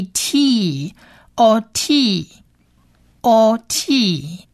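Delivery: "O T" drawn out, long o then long ee; long vowels. Shown as a demonstration. Teacher sounding out the Hebrew word for learners, long vowels. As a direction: slowly model "O T", holding both vowels. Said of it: "变成一个长母音的E的音".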